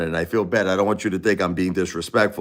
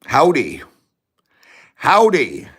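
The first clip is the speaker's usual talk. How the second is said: Texan howdy; 6 semitones higher, 3 dB louder, slower than usual. Pretend. Texan greeting.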